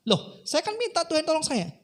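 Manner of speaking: high-pitched acted comeback; indignant protest. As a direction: argue back to God, aggrieved. Dead man arguing back at God. (membantah dengan nada tinggi)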